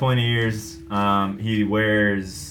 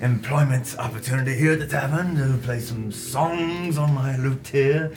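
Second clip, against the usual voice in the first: bard voice; 4 semitones higher than usual. Acted. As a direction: pitch it like a travelling bard. Bard offers to play lute.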